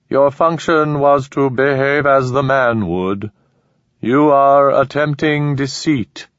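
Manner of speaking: slow, cold alien accusation; measured inhuman judgment. Acted.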